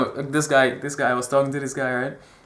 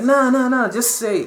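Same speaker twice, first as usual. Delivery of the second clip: high, urgent brush-off; insistent dismissal; 9 semitones higher, 4 dB louder than usual. Retold or imitated. Friend overruling his reluctance to approach girls, insistent dismissal. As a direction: wave the objection away with raised pitch.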